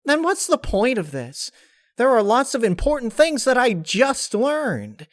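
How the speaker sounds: high, loud protest; high frustrated protest; frustrated Pinkie. Acted. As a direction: push back in disbelief.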